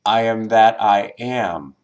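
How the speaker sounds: last "am" emphasized with a falling tune; stress on final AM. Shown as a demonstration. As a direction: run through to a weighted final "am". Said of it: "emphasizing each word in order".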